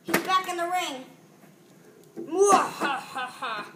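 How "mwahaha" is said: slow low evil cackle; sneering panto villain. Pretend. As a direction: cackle like a cartoon baddie. Villain wrestler gloats.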